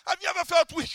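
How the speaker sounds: ordinary talk, nothing marked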